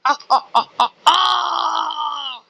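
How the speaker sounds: staccato cries into a long shout; stuttered "ah"s building to a drawn-out yell; panicked cry. Pretend. Panics when caught in game.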